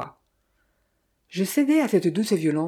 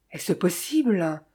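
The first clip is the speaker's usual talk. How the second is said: usual speaking voice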